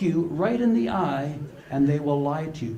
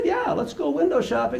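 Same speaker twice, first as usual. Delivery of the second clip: put-on little girl voice; 10 semitones higher than usual. Granddaughter voiced in story.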